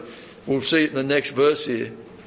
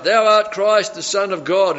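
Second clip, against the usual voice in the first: high, loud mock demon cry; 6 semitones higher, 4 dB louder than usual. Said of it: "the devils are calling out and they're crying out".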